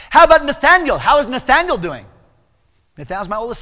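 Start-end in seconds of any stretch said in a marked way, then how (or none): 0.1-2.0 loud, high, exuberant shouted question; Brother Vic. Retold or imitated. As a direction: boom out the friendly question. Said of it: "that's the way he talked".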